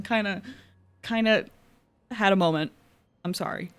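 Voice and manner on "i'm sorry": quiet apology. Real, not acted. Emotional fan moment, voice dropping.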